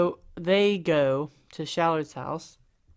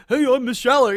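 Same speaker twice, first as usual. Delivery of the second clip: high, loud, overdone character voice; hammed-up high voice, the version to avoid; Miss Shallard; 7 semitones higher, 8 dB louder than usual. Shown as a demonstration. Showing how not to voice her, hammed-up. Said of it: "don't wanna make it like".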